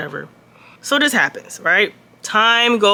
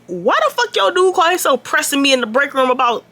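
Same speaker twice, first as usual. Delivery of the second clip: annoyed confrontation; 7 semitones higher than usual. Retold or imitated. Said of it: "I go to Haley and was like".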